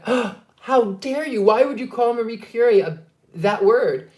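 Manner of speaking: high, loud, scandalized gasp of protest; acted indignation. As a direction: protest in mock outrage at the insult. Acts out shocked objection.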